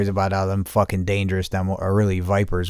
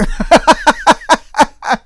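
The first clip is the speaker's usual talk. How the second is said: real laugh, much higher and loud; high howling laugh; 8 dB louder than usual. Real, not acted. Cracking up after telling the lady off, high loud howl.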